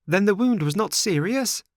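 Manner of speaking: high, pleading marionette voice; anxious puppet boy. A narrator's reading. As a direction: ask the old man with worried hope. Pinocchio voiced in story.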